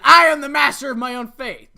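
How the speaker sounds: loud, high heroic proclamation; bold declaration. Acted. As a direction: declare it like a hero. Bold declaration before shouting.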